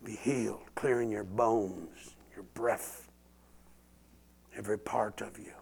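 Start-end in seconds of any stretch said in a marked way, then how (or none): 2.3-5.5 breathy whispered blessing; hushed prayer under the breath. Real, not acted. Whispered prayer during healing.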